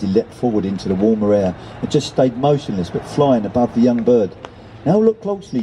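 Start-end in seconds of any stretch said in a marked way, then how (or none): 4.8-5.6 gull voice, teaching. Acted.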